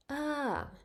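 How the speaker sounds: "ah" starts high then falls down; falling tone ah. Shown as a demonstration. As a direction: lift "ah" high and let it drop. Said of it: "The voice goes up and then back down".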